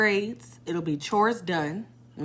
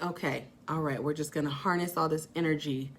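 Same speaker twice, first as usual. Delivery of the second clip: much softer, calm resolve; soft self-talk; 3 semitones lower, 7 dB quieter than usual. Retold or imitated. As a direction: tell yourself softly you've got this. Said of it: "I'm just like".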